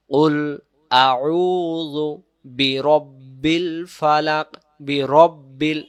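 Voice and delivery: slow, drawn-out chant of বিরব্বিল Falaq; Quran recitation. Shown as a demonstration. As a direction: recite slowly like tilawat. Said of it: "সহি উচ্চারণ হবে".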